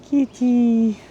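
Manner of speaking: very high sing-song kitty call; cat-calling voice. Real, not acted. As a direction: coax a cat out in a tiny high voice. Coaxing a stray cat.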